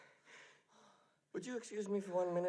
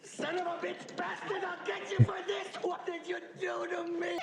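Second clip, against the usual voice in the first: furious shouting; 9 semitones higher, faster than usual. Acted. A victim screaming at his tormentor, furious. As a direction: rage at whoever did this to him. Furious outburst at being wronged.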